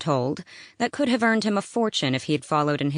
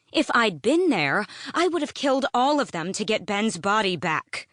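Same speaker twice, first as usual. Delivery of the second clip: higher, heated fury; furious; 7 semitones higher than usual. A narrator's reading. Selena vows vengeance at Sam, higher and heated. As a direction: fling the vow at him. Furious reply after chair flips.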